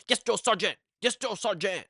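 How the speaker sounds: high, strained mock recruit shout; imitated screaming. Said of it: "would scream it".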